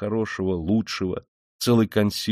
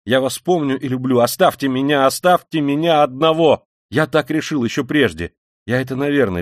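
loud, raised, agitated pleading; insistent plea; 5 semitones higher, 6 dB louder than usual. A narrator's reading. Rodya breaking with his family, agitated pleading. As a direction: beg to be left alone, voice climbing. Tormented plea to be left alone.

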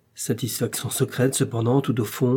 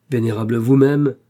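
a mild loud grumble, stung; the old dowager grumbles back, louder; grumbling; 7 dB louder than usual. A narrator's reading. Old dowager snapping at the young man, mildly indignant. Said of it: "grondait la douairière".

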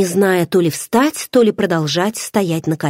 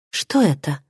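ordinary delivery, nothing marked

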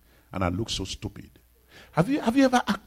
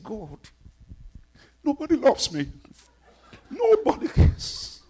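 mock self-pity; 13 semitones higher, 5 dB louder than usual. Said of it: "I said".

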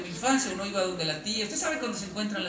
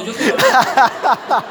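slow, much louder laughing plea; mock eager plea; 5 semitones higher, 15 dB louder, much slower than usual. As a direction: beg like an eager student. Japanese imitated eagerly.

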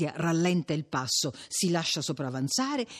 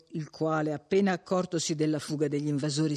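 ordinary delivery

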